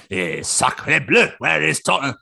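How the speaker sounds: drawn-out mock French exclamation; put-on French accent. Co-host pretending to look for himself, hammy French accent. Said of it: "I put on the French accent".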